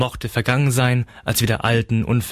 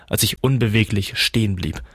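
usual speaking voice

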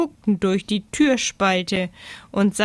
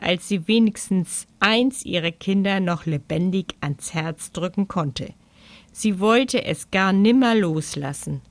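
usual speaking voice

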